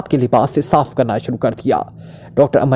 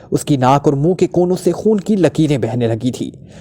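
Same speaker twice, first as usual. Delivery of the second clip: ordinary delivery, nothing marked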